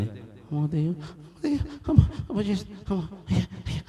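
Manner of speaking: high, thin frightened squeals; acted terror, voice shooting up; panicked at a ghost. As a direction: whimper in mock fright as if seeing a ghost. Acts someone panicking at a ghost.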